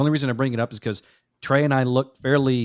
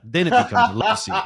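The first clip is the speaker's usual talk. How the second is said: higher voice breaking into laughter; laughing punchline; 9 semitones higher, 4 dB louder than usual. Real, not acted. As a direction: land the joke while cracking up. Delivers teasing punchline, laughing.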